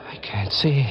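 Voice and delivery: whispered in dread; terrified whisper. Acted. Blinded boy whispering to himself in fear. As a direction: breathe it out in horror.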